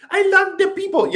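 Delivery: high mock politician voice; lightly hammed-up Macron line. Mocking Macron again, high and playful. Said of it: "that's what Macron".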